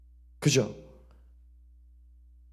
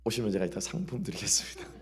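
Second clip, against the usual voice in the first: low, whispered joke to the crowd; hushed playful promise of a prize; whispered aside; 6 semitones lower, 9 dB quieter than usual. Real, not acted. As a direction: slip it in as a secret tease. Whispered joking aside to congregation.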